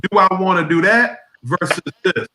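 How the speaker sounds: usual speaking voice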